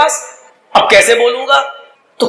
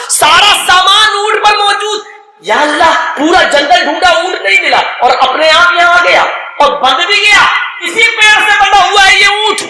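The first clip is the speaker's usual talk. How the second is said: overjoyed disbelief; 7 semitones higher than usual. Acted. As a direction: let the amazement spill over. Overjoyed at finding camel. (خوشی سے بے قابو آواز)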